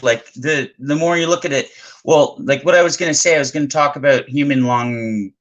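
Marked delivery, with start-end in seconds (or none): none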